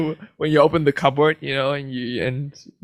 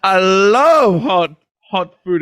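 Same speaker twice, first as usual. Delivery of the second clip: slow, loud, higher hammy line; 5 semitones higher, 6 dB louder, much slower than usual. Pretend. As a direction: belt it out slowly. Fridge voice bit.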